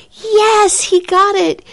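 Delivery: high, jubilant, arms-up shout of joy; triumphant cheer. Retold or imitated. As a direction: celebrate being recognized. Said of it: "he threw his arms up in the air and he was like".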